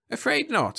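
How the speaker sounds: high-pitched bureaucrat's refusal; official. A narrator's reading. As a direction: turn down the request as an official. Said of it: "said the man".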